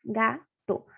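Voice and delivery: "Gato" broken into Ga and to; split into syllables. Shown as a demonstration. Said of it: "duas sílabas". ("Gato" dito sílaba por sílaba)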